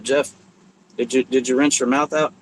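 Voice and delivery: usual speaking voice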